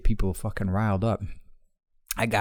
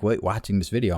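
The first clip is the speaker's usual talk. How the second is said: normal talking voice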